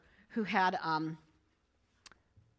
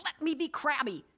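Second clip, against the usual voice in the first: higher, fed-up outburst; crabby Lucy; 6 semitones higher, 3 dB louder than usual. Retold or imitated. Lucy finally telling Linus to let her be crabby. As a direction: snap back that you want to be left grumpy. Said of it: "Finally, at the end, she just".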